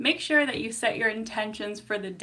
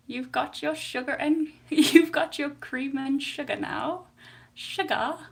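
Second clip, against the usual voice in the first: put-on higher-pitched, playful British accent; 4 semitones higher than usual. Streamer teasing about cream and sugar, playful British accent. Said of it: "I gotta stop doing accents".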